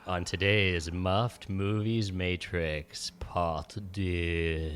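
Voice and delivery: low, slow trailer sign-off; deep trailer voice. Acted. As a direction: drop the voice for the title.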